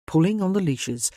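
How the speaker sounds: usual speaking voice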